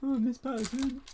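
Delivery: put-on loud high whiny teenager; Kevin the Teenager. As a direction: whine it like a sulky teen. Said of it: "I sound like Kevin the Teenager".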